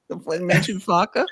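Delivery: high, slow whiny voice on Farke. Pretend. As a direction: whine the line like a sulky kid. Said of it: "whiny voice".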